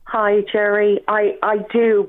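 ordinary talk, nothing marked

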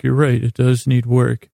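normal talking voice

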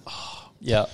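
low, soft, sighing reply; reluctant sigh into the words; weary sigh. Retold or imitated. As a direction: imitate a worker sighing at a late order. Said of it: "you hear him do the sigh".

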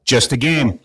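big, high show host opening; announcer intro. Acted. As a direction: boom it out like a radio host.

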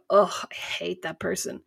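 slow, quiet moan of complaint; grumbling. Acted. Complaining to a friend about someone, soft grumble. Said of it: "if I go".

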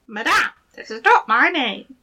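high squeaky little goblin voice; gravelly sly hobgoblin. Acted. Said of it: "said to every one".

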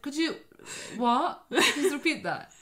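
high, loud, halting comic foreigner; hammed-up confusion, pitched high; baffled foreigner. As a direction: play a lost foreign operator, breaking into a laugh. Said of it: "your local foreigner person going".

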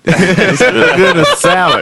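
laughing mock southern accent; smooth southern accent. Podcast guest joining the friend impression, laughing southern accent. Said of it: "that's how Lee".

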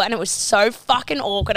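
ordinary talk, nothing marked

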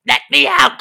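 raging lizard scream; furious creature shout. Acted. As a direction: bellow it like a caged beast. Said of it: "clearly angry and irate".

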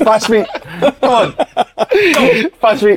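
high, laughing squeaky "pass me"; mock pleading teammate. Mocking player in dressing room video.

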